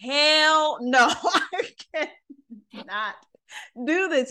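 high, slow panicked cry with a laugh; retelling the panic half-laughing; freaking out. Retold or imitated. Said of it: "I was like".

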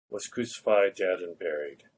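usual speaking voice

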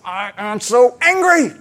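put-on high, slow childish whine of a cross little girl; a girl of four announcing her fury to Dad; angry four-year-old. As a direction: drag out each word like a sulking toddler. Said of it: "And Georgia goes".

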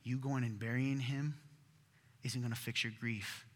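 soft, low, consoling; quiet tender reassurance; gentle comfort. Acted. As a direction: hand on his shoulder, easing his grief. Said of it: "put his hand on him and said".

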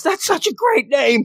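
high over-the-top praise; gushing coach. Retold or imitated. Recapping the coach praising her name, high gushing voice. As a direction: gush it, way up in the voice.

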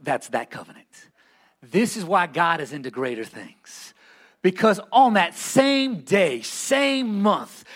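ordinary delivery

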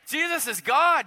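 repeated for an amen. Shown as a demonstration. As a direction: say it again so they answer. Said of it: "I thought I'd get an amen".

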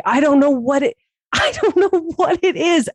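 high, laughing through the words. Real, not acted. Telling her co-host about a bedroom moment, laughing. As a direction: giggle it out, embarrassed.

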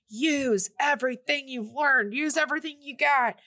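rally her like a coach; lightly voiced teachers cheering her on; pep talk. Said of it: "they're like".

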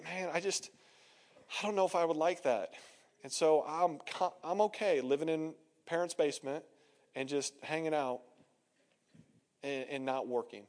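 low, soft, noncommittal mumble of an excuse; half-hearted shrug. Acted. Young man excusing living in parents' basement, half-hearted. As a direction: trail down quietly, content to do nothing.

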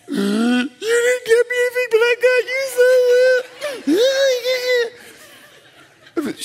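high whiny voice of a tearful wife; crying wife impression. Husband mimics wife crying at Christmas, high and whiny. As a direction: whine it like a hurt wife, pitched high.

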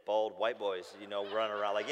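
normal talking voice

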